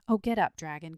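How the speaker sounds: loud, piteous plea; piteous cry. A narrator's reading. Boy pleading with the sleeping dragon, piteous. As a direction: cry out in mild distress. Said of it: "cried the boy piteously".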